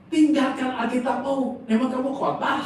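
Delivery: loud, high retold command; clear voice of God. God orders the preacher clearly, loud and high. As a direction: boom out a divine order, acted. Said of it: "Itu jelas suara Tuhan".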